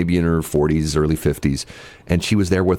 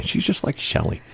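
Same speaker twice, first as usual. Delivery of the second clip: unvoiced, much softer aside; whispered aside; 7 semitones higher, 5 dB quieter than usual. Real, not acted. Host teasing co-host about the weather lady, whispered aside. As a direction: whisper it like a secret jab.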